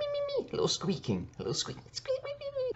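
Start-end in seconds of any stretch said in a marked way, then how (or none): 0.0-0.5 tiny high squeak voice; squeaky critter noise. Retold or imitated. Mimicking a critter above her head, tiny squeak. 2.2-2.7 tiny high squeak voice; squeaky critter noise. Retold or imitated. Mimicking a critter above her head, tiny squeak.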